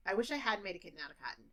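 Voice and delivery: the whole sentence rushed, fast; fast native speed. Shown as a demonstration. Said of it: "if I'm a teacher".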